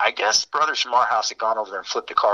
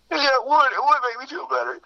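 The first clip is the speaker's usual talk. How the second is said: much higher, whiny sobbing yes; mock tearful reply. Heartbroken friend tearfully agreeing to flip the car. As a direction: ham up a weepy, pitiful agreement.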